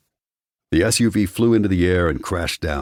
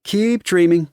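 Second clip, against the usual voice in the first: high, louder teasing put-down; eye-rolling dismissal; 5 dB louder than usual. A narrator's reading. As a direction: roll your eyes as you say it. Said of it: "giving him an exaggerated eye roll".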